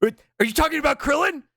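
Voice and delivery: high, mock-outraged yell; high-pitched overblown warning; imitated outburst. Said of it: "It's got the same energy".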